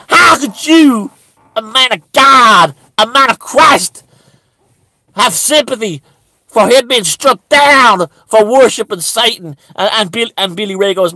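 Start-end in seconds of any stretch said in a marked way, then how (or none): 0.1-9.6 raised voice accusing the pastor; outraged pleading. Retold or imitated. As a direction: demand an answer in outrage.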